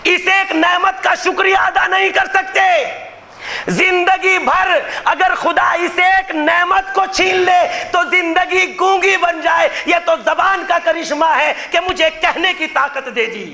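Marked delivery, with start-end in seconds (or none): none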